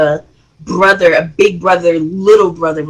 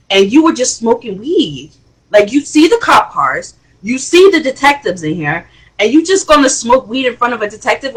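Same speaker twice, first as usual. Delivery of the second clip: exasperated father scolding; 3 semitones higher than usual. Retold or imitated. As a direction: scold him like a fed-up dad. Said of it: "Jock went on to say".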